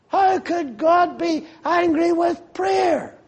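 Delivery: high, slow, shouted question; indignant shout. Real, not acted. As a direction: hurl the question at the congregation. Preacher's indignant rhetorical question.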